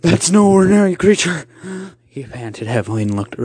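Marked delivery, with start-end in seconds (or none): 0.0-1.4 high, loud panicked cry; screaming in alarm. Acted. Uncle waking in terror of the creature, screaming. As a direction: cry out as if jolted awake. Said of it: "finally woke up and screamed".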